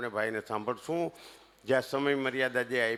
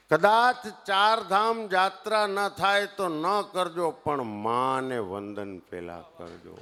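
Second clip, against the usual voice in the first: preachy declaration; 4 semitones higher, 4 dB louder, slower than usual. Real, not acted. Preaching reverence for mothers to elders.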